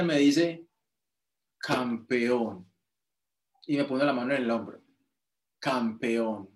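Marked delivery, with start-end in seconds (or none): 1.6-2.7 campeón apagado, sin energía; saludo sin chispa. Retold or imitated. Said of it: "Y ese día no". 5.6-6.5 campeón apagado, sin energía; saludo sin chispa. Retold or imitated. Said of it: "Y ese día no".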